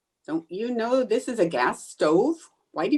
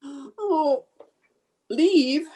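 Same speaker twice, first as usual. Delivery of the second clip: high, tearful alarm; comic panic at being left alone; dismayed; 6 semitones higher than usual. Acted. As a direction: gasp it out, rising in dismay. Alarmed that friend will leave.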